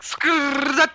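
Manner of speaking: high, loud skrrt noise; skidding tire screech. Retold or imitated. Friend says Cena sounds like a skidding screech. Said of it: "John Cena sounds like".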